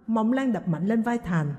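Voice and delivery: plain reading voice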